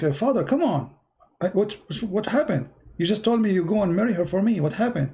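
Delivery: high, whiny son begging father; pleading son. Retold or imitated. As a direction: plead upward, baffled and hurt.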